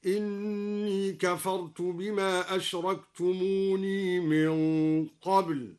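أشركتموني chanted slowly with the final ya kept, ashraktumuni; slow tajweed chant sounding the final ni of أشركتموني; Quranic recitation. Shown as a demonstration. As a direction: keep the ya of أشركتموني in connected recitation. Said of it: "وأثبت الياء في أشركتموني وصلا هكذا".

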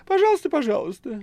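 very high, loud squeaky cat; high-pitched cartoonish cat voice; cat Behemoth. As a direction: squeal it like a cheeky talking cat. The cat answers Woland.

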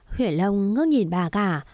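ordinary delivery

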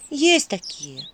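mild, resigned sigh. Acted. Vasilisa admits others hold those names, sighing. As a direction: breathe a small sigh into it. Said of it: "вздохнула Василиса".

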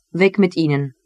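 usual speaking voice

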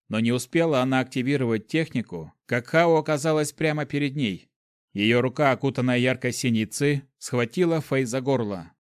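ordinary delivery

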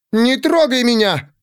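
high-pitched, angry protest; indignant outcry. A narrator's reading. Pandora as the servant catches her, indignant outcry. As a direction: cry out to repel his touch.

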